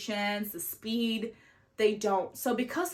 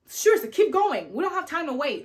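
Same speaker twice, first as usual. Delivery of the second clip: exasperated; 6 semitones higher, 5 dB louder than usual. Retold or imitated. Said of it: "I'm like".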